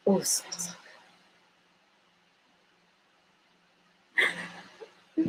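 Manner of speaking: whispered, savoring the scent; savoring a smell. Real, not acted. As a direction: breathe it out in delight. Smelling palo santo piece.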